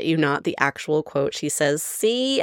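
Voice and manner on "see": Mae West "See". Retold or imitated. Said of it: "read it in the Mae West accent".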